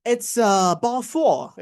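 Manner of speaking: London "bottle of water" swallowed to baw-fo; London accent. Acted. A Briton answers what is this, London accent. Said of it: "London বা এই এরিয়ার লোকজন এরকম ভাবে কথা বলে".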